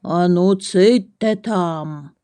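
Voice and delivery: slow, mildly growly bear; deep growly voice. A narrator's reading. Bear hushes the chatter, slow mild growl. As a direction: lightly growl it, drawing the words out. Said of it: "глибокий, гаркітливий голос".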